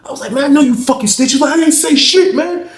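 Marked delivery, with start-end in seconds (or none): 0.3-1.3 high, loud accusing yell; angry accusation. Retold or imitated. Acted quarrel, accusing. 1.6-2.7 high, loud panicked denial; defensive denial. Retold or imitated. Snitch denies.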